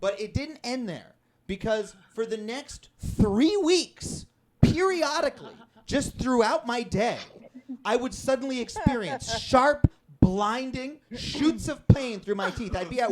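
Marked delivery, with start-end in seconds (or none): none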